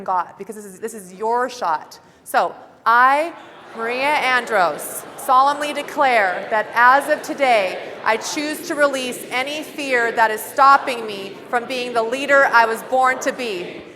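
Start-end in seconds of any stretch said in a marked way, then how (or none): 2.2-13.8 loud, slow, declaring every word; full-force pledge. Shown as a demonstration. Leading the room in the pledge, loud and slow. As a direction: give it everything you've got. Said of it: "give it your, all you've frickin' got".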